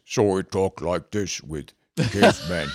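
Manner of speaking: slow, dim caveman talk. Shown as a demonstration. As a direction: speak slowly like a simple caveman. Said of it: "and have a caveman voice".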